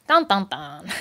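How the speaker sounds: sung doom sting, falling low at the end; dramatic dun dun dun. Pretend. Mocking her own gloom, sung dramatic sting. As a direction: ham up a mock ominous sting.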